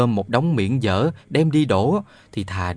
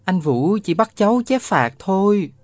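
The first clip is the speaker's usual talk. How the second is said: put-on boy denying; 9 semitones higher than usual. A narrator's reading. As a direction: insist nothing happened. Boy voiced in story. (cậu bé chối, giọng cao)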